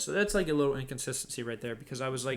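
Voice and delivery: normal talking voice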